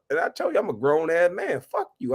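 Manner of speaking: ordinary talk, nothing marked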